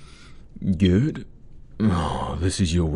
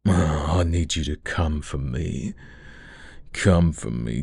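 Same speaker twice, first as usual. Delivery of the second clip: normal talking voice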